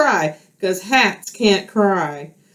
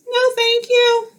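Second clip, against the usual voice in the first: much higher little frog voice; Frog's voice; 13 semitones higher than usual. Acted. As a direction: squeak a polite refusal. Frog declines the cap.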